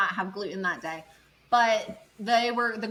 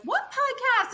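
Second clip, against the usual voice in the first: surprised question; slower than usual. Retold or imitated. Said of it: "this girl's like".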